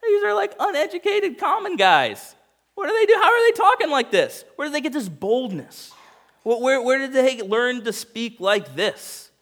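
high, loud, incredulous questions; stunned religious leaders questioning in disbelief; astonished disbelief. Acted. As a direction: sound floored by what you hear. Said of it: "they're like".

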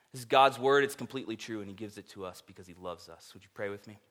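usual speaking voice

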